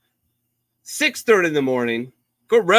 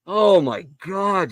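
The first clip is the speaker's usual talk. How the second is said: slow, drawn-out disbelief; exasperated groan; 6 semitones higher, much slower than usual. Retold or imitated. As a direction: stretch it out, fed up with them. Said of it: "I'm like".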